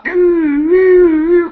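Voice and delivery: high, drawn-out siren "doo"; imitated police siren. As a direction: wail it like a mock siren. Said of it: "putting red light on his car".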